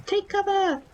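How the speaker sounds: high, urgent shout of warning. Acted. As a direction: yell to the troops to take cover. Soldier calling out warning.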